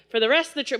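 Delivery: ordinary delivery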